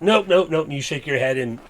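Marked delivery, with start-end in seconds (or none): 0.0-0.6 higher, louder mock refusal; hammed-up louder character refusal; refusing in character. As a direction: shake it off loudly, nope after nope. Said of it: "You're like".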